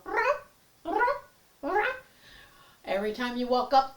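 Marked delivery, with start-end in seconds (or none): 0.0-2.0 high little ruff sounds; high rising mock barks; imitated cat chirp. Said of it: "She'd go".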